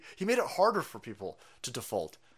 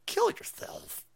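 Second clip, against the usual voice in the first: breathy whispered punchline; slower than usual. Retold or imitated. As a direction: whisper it like a dark secret. End of mock outlet quote.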